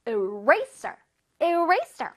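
"Eraser" with RA punched clearly; stress on RA. Shown as a demonstration. Teacher drilling pupils, distinct stress on RA. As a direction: lean hard into RA in "Eraser". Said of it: "R A 부분을 선생님이 뚜렷하게".

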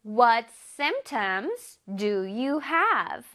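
very slow, careful English; slow teacher demo; slow model reading. Shown as a demonstration. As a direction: spell out each word slowly for students. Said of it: "선생님이 읽어볼게".

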